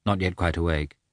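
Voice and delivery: usual speaking voice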